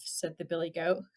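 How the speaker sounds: plain reading voice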